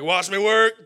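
loud cocky boast, hammed-up; mock boast. Swimmer boasting to onlookers, loud mock swagger. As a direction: show off before stepping onto the pool. Boasting before walking on water.

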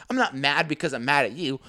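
ordinary talk

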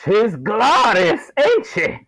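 high, slow admiring voice; admiring leprechaun. Acted. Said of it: "he says".

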